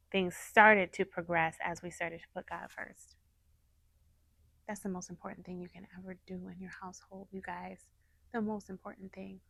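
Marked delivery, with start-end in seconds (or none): 4.7-9.4 hushed, urgent whisper; quick whisper stressing what matters most. Real, not acted. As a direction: lean in and whisper it to them. Urgent point to viewers.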